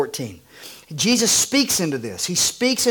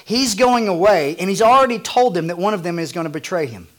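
ordinary talk

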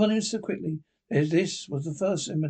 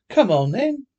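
louder, higher cheerful retort; merry comeback; gaily; 6 semitones higher, 7 dB louder than usual. A narrator's reading. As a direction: toss it back gaily with a big swooping lift. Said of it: "nephew gaily".

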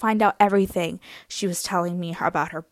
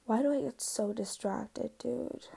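usual speaking voice

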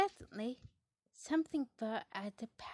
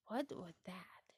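usual speaking voice

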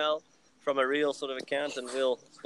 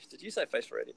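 normal talking voice

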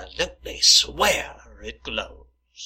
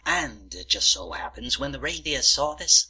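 ordinary talk, nothing marked

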